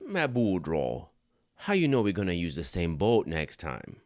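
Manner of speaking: put-on Thibodeau drawls the punchline in Cajun accent. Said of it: "the best Cajun accent I got".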